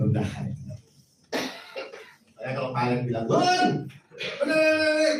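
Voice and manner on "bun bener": very high, sing-song mock answer; squeaky mock reply. Preacher mimics a church worker's cheeky reply to a leader.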